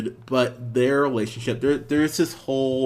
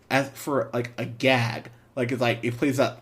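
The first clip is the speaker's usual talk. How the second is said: usual speaking voice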